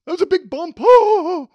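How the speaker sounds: jolted driver, panicking over a bump; on a bumpy road. Acted. Acting out a worried driver.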